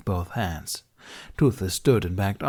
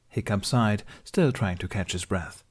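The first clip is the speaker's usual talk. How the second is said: plain reading voice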